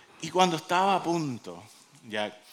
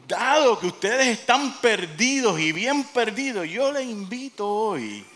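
raised, emphatic preacher's challenge; bold challenge; 5 semitones higher, 4 dB louder than usual. Retold or imitated. Archbishop to the intimidating militia, boldly challenging. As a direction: throw down a bold invitation.

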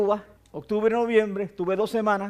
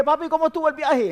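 high-pitched call, only mildly raised; retold calling out; 6 semitones higher than usual. Son hailing his father at the airport, high mock call-out. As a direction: imitate hailing someone at a distance. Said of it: "le grité".

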